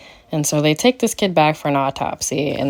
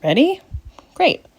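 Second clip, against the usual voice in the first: chirpy high ready; 12 semitones higher than usual. Real, not acted. Host teasing before spoilers.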